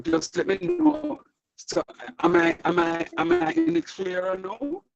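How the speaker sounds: ordinary talk, nothing marked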